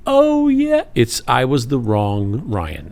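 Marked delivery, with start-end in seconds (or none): 0.1-0.8 high, loud sudden recognition; mock recognition. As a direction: act out the penny dropping. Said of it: "they go".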